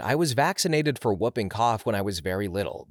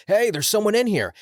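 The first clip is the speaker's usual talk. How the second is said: raised, high-pitched call to an accomplice; 11 semitones higher, 7 dB louder than usual. A narrator's reading. Burglar alerting his partner outside the locked room, calling out. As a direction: call it out higher and louder, as an alert.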